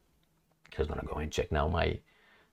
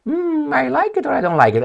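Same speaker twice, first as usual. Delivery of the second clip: much higher put-on woman's voice; mock woman. A woman accosted on the street, high mock voice. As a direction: squeak it out like a flustered lady. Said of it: "And she's like".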